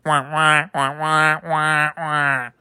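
muted trombone wah wah; mock the droning adult voice from Peanuts; Charlie Brown adult wah wah. Mocking a pundit as Charlie Brown's droning grown-up. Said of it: "Charlie Brown's grandmother".

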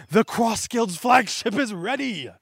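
high, loud, slow proclamation; grand announcement. Acted. Lackey unveiling the finished flagship, loud slow proclamation. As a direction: announce it to the whole crew.

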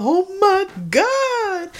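high, loud, drawn-out mock wail; hammed-up relieved cry, stretching every word; wailing aunt. Aunt greets long-missing nephew at door.

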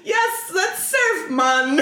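high, slow mock Californian surfer with a Jamaican tinge; badly faked California accent sliding into Jamaican; California gone Jamaican. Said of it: "here's California".

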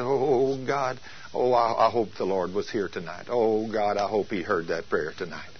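low, trailing-off voice of faint hope; doubtful hoping. Retold or imitated. Doubtful man after a prayer.

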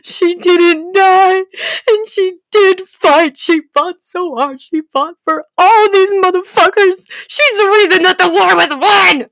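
loud, high, impassioned defense; passionate defense. Real, not acted. Defending Hermione to viewers, loud and impassioned. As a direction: fight for her honor.

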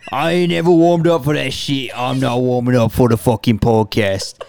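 Birmingham gangster voice drifting Australian; Arthur Shelby impression. Co-host does his Arthur impression for a cold open, gangster accent. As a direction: growl it like a Peaky Blinders heavy. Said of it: "I want you to do the impression".

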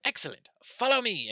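high, loud, piping command; eager gnome guide. Acted. Gnome leading the party off, eager squeak. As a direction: pipe up and lead the way.

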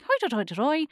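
hint of a cartoon leprechaun; mock leprechaun. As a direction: babble like a caricature leprechaun. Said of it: "everyone knows what a leprechaun sounds like".

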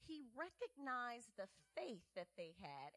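usual speaking voice

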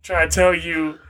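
ordinary talk, nothing marked

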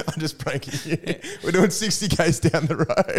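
giggling through the confession, rising pitch; laughing at the prank. Retold or imitated. Son laughing as he admits the fake dog to Mum. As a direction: laugh while owning up to the trick. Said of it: "I go".

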